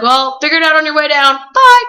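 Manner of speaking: high, fast and loud, ending in a squeaky bye; cheerfully abandon her on the slope; teasing friends. Retold or imitated. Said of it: "they're like".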